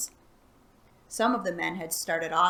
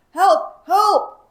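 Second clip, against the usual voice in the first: high, loud gruff cry for help; 11 semitones higher, 14 dB louder than usual. Acted. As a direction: bellow it like a trapped man. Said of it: "he cried in a gruff voice".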